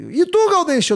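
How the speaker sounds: high-pitched radio cue; chamando o colega. Retold or imitated. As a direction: toss the question over, pitched high. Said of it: "o Fetter me chamou assim". (chamada animada, em tom agudo)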